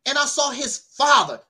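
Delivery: real shout in anger; angry shout. Real, not acted. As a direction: shout it out in fury. Raised voice in anger.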